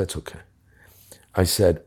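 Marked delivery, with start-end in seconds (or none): 0.0-0.4 much softer, near-whispered no thanks; quiet brush-off. Retold or imitated. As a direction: wave it off under your breath. Declining the bridge climb.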